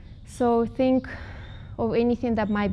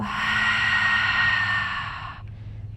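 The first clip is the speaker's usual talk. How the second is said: long, voiceless breathy "haaa"; empty the lungs in one drawn-out open-mouth sigh; ha exhale. Shown as a demonstration. Teacher leads students in another exhale, long whispery ha sigh. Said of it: "ha sound".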